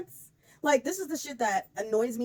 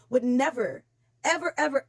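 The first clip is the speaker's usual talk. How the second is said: each "ever" punched higher and louder; emphatic repetition; 4 semitones higher, 4 dB louder than usual. Real, not acted. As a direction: hammer "never, ever, ever" home. Emphatic rant against Sabrina.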